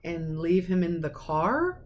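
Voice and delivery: slow, wondering aunt; mildly slow suggestion; slowly. Acted. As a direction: draw the idea out slowly. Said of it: "said Aunt Petunia slowly".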